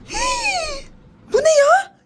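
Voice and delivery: high-pitched astonishment; shocked gasp. Acted. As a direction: gasp and exclaim in amazement. Mother sees the astronaut.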